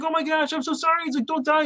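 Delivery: high, soft pleading voice. Retold or imitated. Harry holding the dying octopus, high soft pleading. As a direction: beg softly in a high voice.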